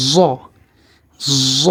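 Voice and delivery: জ opened with a long z buzz; জ with the z held; Arabic heavy Z. Shown as a demonstration. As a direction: demonstrate জ as emphatic "zzo". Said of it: "উচ্চারণটি আমরা আবার খেয়াল করি".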